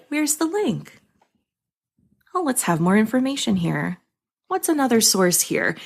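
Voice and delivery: gentle, lifted, soothing reading; calming Bob Ross voice. Shown as a demonstration. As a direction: read feedback as a kindly, calming helper. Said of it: "a Bob Ross voice. Read it in a calming voice".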